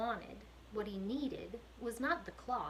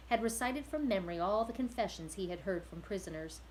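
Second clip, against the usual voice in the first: usual speaking voice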